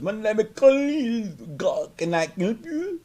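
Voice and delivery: high, slow put-on foreign voice; mock tech support agent. Mocking the agent introducing himself, high slow put-on voice. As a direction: parody an agent greeting a caller. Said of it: "I don't even know what your name was".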